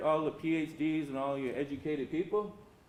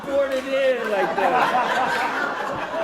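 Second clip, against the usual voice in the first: laughing hard through the words, louder; can barely get it out for laughing; real laughter breaking up a slow, drawn-out line; 5 semitones higher, 8 dB louder, slower than usual. Real, not acted. Laughing through the house story.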